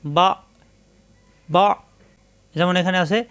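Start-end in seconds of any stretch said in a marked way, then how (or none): 0.0-1.6 "বা" closed abruptly, no bounce; "বা" with a hard glottal stop; sound shut off. Shown as a demonstration. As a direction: say each "বা" and lock it in the throat. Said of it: "আওয়াজটা এখানে এসে বন্ধ হয়ে যাবে".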